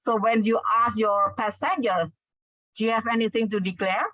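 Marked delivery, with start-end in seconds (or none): 2.8-4.1 quick question, "declare" lifting at the end; slightly rising intonation. Shown as a demonstration. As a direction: ask briskly, raise the tone on "declare". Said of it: "you have rising intonation a bit".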